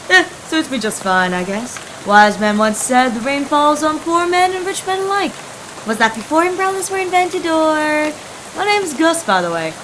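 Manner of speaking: a carefree hobo shrugging off the rain; easygoing hobo Gus. Acted.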